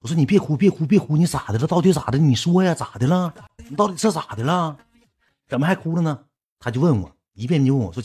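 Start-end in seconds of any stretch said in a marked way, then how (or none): 0.2-6.2 higher, louder, pressing retold phone reaction; acted-out agitated pleading; urgent questioning. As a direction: fire off worried questions at a crying caller. Urgent questions to crying caller on phone.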